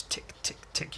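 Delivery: whispered clock ticks; hushed, evenly spaced ticks; ticking clock. Acted.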